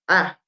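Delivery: short "ah" dropping downwards; "ah" with a quick falling tone; cuarto tono. Shown as a demonstration. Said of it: "va hacia abajo". ("ah" corto hacia abajo)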